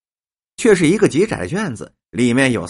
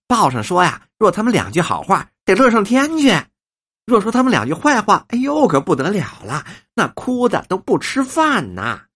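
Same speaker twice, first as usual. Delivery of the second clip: higher-pitched gossipy exaggeration; chatty old woman, animated; 7 semitones higher than usual. Old woman tells guests about young actors, animated and gushing. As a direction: play up their joy and woe, high and lively.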